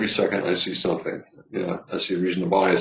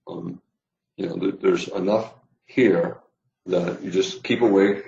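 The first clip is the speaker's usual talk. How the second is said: ordinary talk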